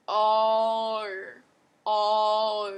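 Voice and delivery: loud, held-out "R" as a vowel drill; Australian O sound. Shown as a demonstration. Tutor demonstrating the vowel to practise, long loud Australian O. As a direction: stretch "R" into a long Aussie O. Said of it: "you do, like".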